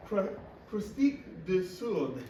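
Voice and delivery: "croustique de soude" drawn out with French-Canadian sounds; Quebec French name. Retold or imitated. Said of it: "in Quebec, they called it".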